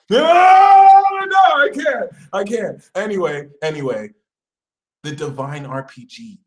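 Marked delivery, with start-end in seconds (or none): 0.1-2.8 very high, loud cry, easing off; hammed-up tearful resistance; mock wailing refusal. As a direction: howl it, then subside. Sagittarius resisting in tears.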